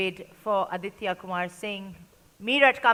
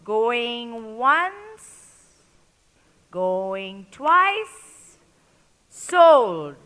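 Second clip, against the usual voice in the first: auctioneer; 4 dB louder, much slower than usual. Real, not acted. Auctioneer closing the bid.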